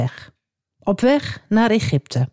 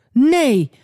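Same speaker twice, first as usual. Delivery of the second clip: crying out; 7 semitones higher, much slower than usual. Acted. Father Jacob refusing to let Benjamin go, crying out. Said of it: "riep hij uit".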